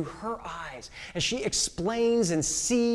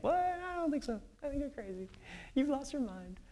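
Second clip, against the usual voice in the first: much higher, softer, mocking; scoffing disbelief; 6 semitones higher, 8 dB quieter than usual. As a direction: brush her off as crazy. Disciples dismiss Mary.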